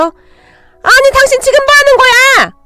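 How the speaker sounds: very high, drawn-out shriek; shocked. A narrator's reading. Mother knocked down by the mother-in-law, shocked shriek. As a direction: screech in outraged disbelief. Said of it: "친정엄마가 놀라서".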